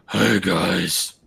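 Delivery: slow raspy impersonation; Angron's raspy voice. Retold or imitated. Imitating an absent co-host for laughs, raspy voice. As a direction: rasp it out like the guy being imitated. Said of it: "he does have that raspy voice".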